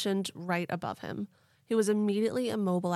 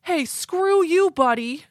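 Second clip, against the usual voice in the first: much higher, louder, slow hammed-up yell; dad voice; 10 semitones higher, 11 dB louder, slower than usual. Ed the abductee telling off aliens in a hammed-up dad voice. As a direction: bark it at them like an annoyed dad. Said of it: "That's a good dad voice".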